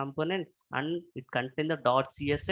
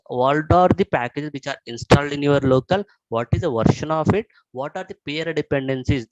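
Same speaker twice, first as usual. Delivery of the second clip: usual speaking voice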